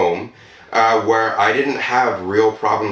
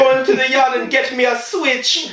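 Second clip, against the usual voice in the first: high Trinidadian mother giving orders; stern Trinidadian accent, raised pitch; 14 semitones higher than usual. Retold or imitated. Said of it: "I just stumbled over the accent".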